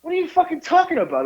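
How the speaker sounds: high-pitched mock indignation; high, exasperated retort in a story; retold indignant outburst. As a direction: act out an incredulous challenge. Retold car confrontation.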